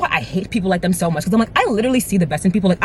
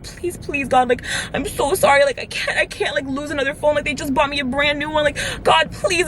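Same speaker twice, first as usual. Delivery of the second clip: tearful praying; 6 semitones higher than usual. Retold or imitated. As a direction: re-enact begging God through tears. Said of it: "crying and praying to God".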